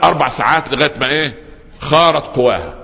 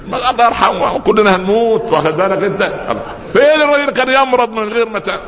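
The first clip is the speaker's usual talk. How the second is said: mock wife; 10 semitones higher than usual. Wife voiced in a joke.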